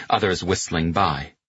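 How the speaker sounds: usual speaking voice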